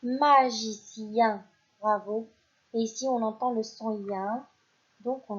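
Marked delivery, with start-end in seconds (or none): 0.0-1.5 "magicien" stretched out syllable by syllable, very slow; slow dictation. Shown as a demonstration. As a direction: spell out "magicien" slowly for the children. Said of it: "c'est un".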